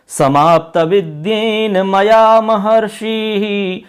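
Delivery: slow, measured Sanskrit chant; shloka recitation. Shown as a demonstration. Teacher recites the whole shloka for students to repeat. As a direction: recite the verse slowly and rhythmically. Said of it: "पूरे श्लोक का उच्चारण करते हैं".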